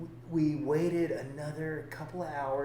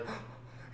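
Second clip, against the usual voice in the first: sharp gasp; 15 dB quieter than usual. Retold or imitated. Moved client in tears.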